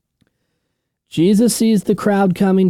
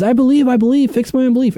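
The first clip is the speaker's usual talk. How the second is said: high, strained plea of a desperate father; pleading father; 8 semitones higher, faster than usual. Retold or imitated. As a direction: beg like a father afraid for his son. Quoting the father again.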